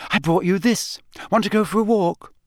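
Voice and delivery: a clever schoolgirl offering toast; Hermione voice. A narrator's reading.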